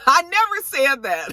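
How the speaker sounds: high-pitched mock protest; mock denial. Retelling Candace's denial between laughs, mock indignant.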